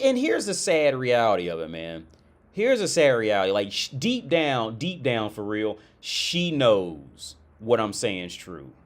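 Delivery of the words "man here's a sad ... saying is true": usual speaking voice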